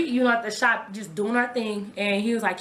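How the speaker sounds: usual speaking voice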